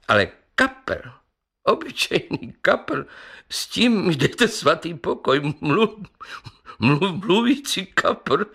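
high-pitched scoffing councillor; incredulous, amused councillor. A narrator's reading. Councillor mocks the talking carp to the company, amused and incredulous. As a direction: scoff in a high voice, stumbling on the last words. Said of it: "a rozesmál se znova".

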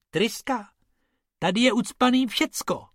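high incredulous retort; exasperated rabbit. Acted. Bob sees sand instead of an engine, high and incredulous. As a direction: scoff at the idea, pitched high. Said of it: "řekl Bob".

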